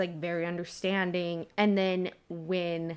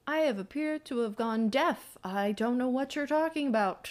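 higher-pitched, airy put-on headmaster voice; Dumbledore feigning ignorance; 5 semitones higher than usual. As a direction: play innocently oblivious. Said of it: "And Dumbledore's like".

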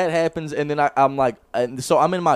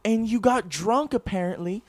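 high, incredulous mother's scold; scolding mom; 9 semitones higher, slower than usual. Retold or imitated. Mom telling son what he did, high, disbelieving scold. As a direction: play his outraged mom setting him straight.

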